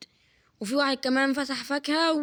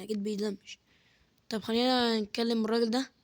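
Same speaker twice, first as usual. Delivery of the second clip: normal talking voice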